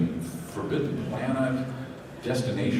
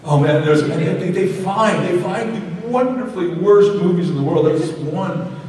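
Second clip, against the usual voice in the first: higher and louder, delighted; excited; 10 semitones higher, 7 dB louder than usual. Real, not acted. Answering a fan, excited and louder. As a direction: gush about the bad movies.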